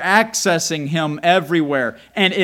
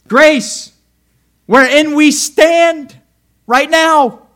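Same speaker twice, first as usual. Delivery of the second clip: proclaiming; 11 semitones higher, 11 dB louder, much slower than usual. Acted. Preacher proclaims verse.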